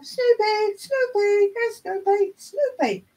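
high, sing-out pet call; calling a dog. Acted. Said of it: "where we call".

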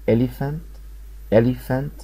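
normal talking voice

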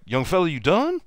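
high-pitched needling questions; Michael, taunting. Retold or imitated. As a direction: imitate a veteran ribbing a beaten youngster. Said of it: "this is Michael talking".

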